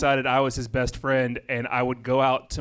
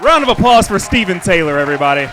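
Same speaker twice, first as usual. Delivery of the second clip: loud closing call to the audience; crowd sign-off; 3 semitones higher, 11 dB louder, faster than usual. Real, not acted. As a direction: project it to the whole room. Live show sign-off to crowd.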